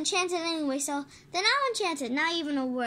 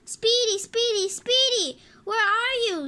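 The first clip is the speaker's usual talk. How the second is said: high, raised calling for a lost dog; searching shout, pitched up; 4 semitones higher, 4 dB louder than usual. Acted. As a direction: call out for someone missing.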